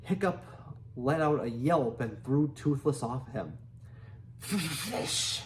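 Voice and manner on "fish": high, drawn-out fricative hiss; hissing dragon. A narrator's reading. Toothless demands food from Hiccup, hissing. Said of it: "hissed Toothless".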